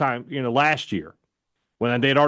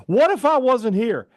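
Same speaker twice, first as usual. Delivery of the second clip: high, raised-voice mock alarm; frantic mom; 8 semitones higher, 4 dB louder than usual. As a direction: imitate an upset mother's challenge. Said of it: "she was like".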